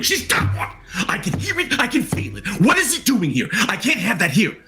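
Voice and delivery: high, frantic patient voice; agitated patient voice. Acted. GM voices a frightened patient hearing something. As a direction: panic over something she can sense in the room. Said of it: "this voice inside going".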